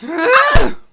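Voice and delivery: high, loud villain's cackle; evil laugh. Acted. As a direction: cackle in triumph at the grand finale. Villain gloats over killing everyone.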